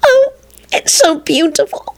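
high, slow weepy voice; tearful gushing. Acted. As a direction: blubber it, moved by the film. Woman crying at sentimental film.

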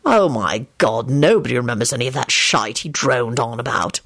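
low, exasperated grumble; snapped. A narrator's reading. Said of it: "Lupin snapped".

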